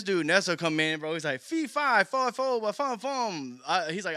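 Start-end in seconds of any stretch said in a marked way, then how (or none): 1.4-3.6 mock silly rhyme. Imitating Nesto's silly verse start.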